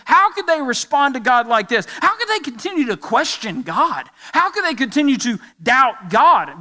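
appalled on God's behalf; indignant disbelief. Acted. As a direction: ask in acted, rising outrage. Voicing readers' indignant thoughts.

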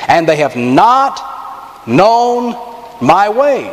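slow "not", pause, a high "known", pause, "my way"; paused emphasis. Acted. Pastor stressing God's complaint, slow with pauses. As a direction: hang on every word.

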